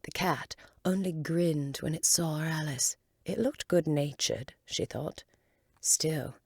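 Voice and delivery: usual speaking voice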